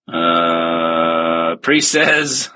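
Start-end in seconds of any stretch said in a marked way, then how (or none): none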